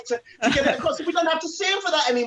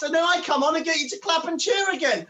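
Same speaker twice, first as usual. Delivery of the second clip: ordinary talk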